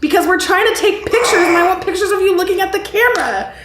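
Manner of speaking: exasperated retort. Retold or imitated. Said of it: "I'm like".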